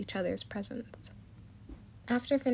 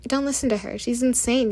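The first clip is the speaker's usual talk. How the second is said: loud, indignant shout; angry yell; 3 semitones higher, 9 dB louder than usual. A narrator's reading. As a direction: yell it over the others. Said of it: "yelled Daring".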